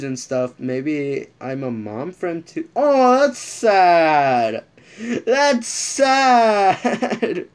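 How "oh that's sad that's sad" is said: high, drawn-out whiny "that's sad"; mock sad wail. Real, not acted. Reacting to a sad post, whiny wail. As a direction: wail it like it hurts.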